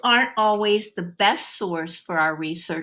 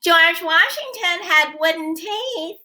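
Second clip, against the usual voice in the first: cutting through; 9 semitones higher than usual. A narrator's reading. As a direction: cut through the noise. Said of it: "Carmen's voice cut through".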